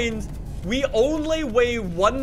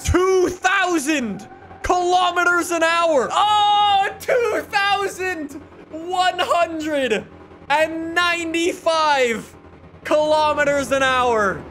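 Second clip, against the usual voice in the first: excited shout; 4 semitones higher, slower than usual. Real, not acted. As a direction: shout it out in amazement. Record speed reached, shouting.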